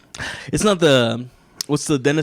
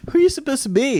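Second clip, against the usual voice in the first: high mocking girl voice, much higher; 13 semitones higher than usual. Retelling the girls who mocked his costume, high mocking voice. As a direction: sneer it like the girls at the party.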